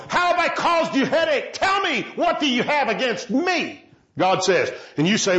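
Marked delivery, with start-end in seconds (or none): 0.1-3.8 urgent demand. Retold or imitated. God demanding an answer.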